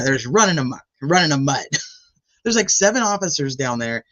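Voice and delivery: usual speaking voice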